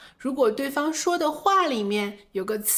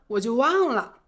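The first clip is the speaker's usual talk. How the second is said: ordinary talk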